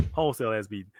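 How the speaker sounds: "Wholesale ass beating" said again low and quiet, savoring it; quiet echo. Shown as a demonstration. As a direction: mutter "Wholesale ass beating" back under your breath. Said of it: "That was a wholesale ass beating".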